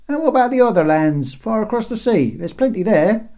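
high, loud chiming-in; another heckling guard. A narrator's reading. Another guard adding far lands, heckling from above. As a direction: add your quibble from the wall. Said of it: "added another".

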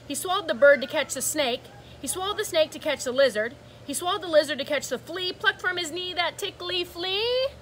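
ordinary delivery

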